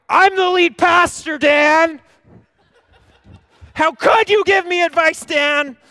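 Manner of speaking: over-the-top offended pastor yelling at Dan; mock indignant outburst. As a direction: rant like a wounded ego. Pastor acts offended inner rant.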